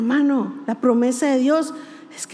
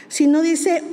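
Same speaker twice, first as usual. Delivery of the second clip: ordinary delivery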